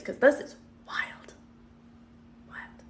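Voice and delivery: breathy stage whisper of disbelief. Real, not acted. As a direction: whisper it as if it's scandalous. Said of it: "I'm calm".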